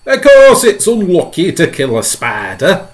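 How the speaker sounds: loud, high indignant shout; shouting centipede. Acted. Centipede shouting down the worry about spiders, loud. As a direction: bellow it at the others. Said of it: "shouted the centipede".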